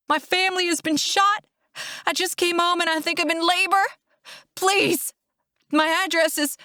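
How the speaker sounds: high, tearful emergency call; distraught, high-pitched pleading; panicked caller. A narrator's reading. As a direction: beg for help in a panic. Emergency call, shot family, in labor.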